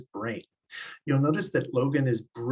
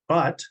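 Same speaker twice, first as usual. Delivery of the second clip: "but" punched louder and higher; stress on "but"; 4 semitones higher, 5 dB louder than usual. Shown as a demonstration. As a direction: set "but" apart with a firm, loud stress. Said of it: "He's gonna stress words like".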